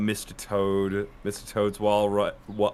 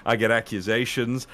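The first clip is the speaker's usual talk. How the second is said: ordinary talk, nothing marked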